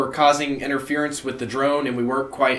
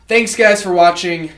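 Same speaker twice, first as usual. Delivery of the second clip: cheery sign-off; 6 semitones higher, 8 dB louder than usual. Real, not acted. Loud sign-off outro.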